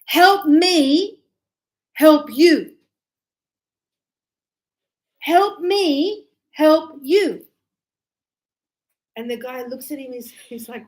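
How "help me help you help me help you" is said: loud, pleading chant, rising then falling; Jerry Maguire shout. Retold or imitated. An agent begging his player for help, loud and insistent. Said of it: "When he goes".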